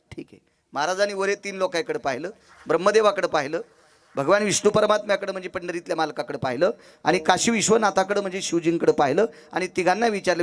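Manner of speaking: ordinary talk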